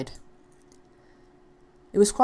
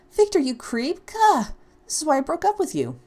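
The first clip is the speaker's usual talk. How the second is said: angry outburst; 10 semitones higher than usual. Acted. Ashley fuming at her stalker ex Victor, angry.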